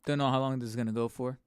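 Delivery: ordinary talk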